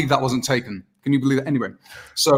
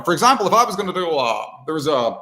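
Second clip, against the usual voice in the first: ordinary talk, nothing marked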